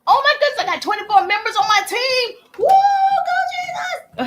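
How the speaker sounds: high, loud squeal building to a whoop; ecstatic celebration. Real, not acted. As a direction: cheer wildly at the milestone. Celebrating twenty-four team members.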